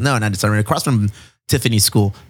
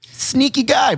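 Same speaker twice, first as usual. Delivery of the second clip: pitched-up teasing, sneaky guy; high mock accusation; 13 semitones higher, slower than usual. Real, not acted. Amused remark about officer.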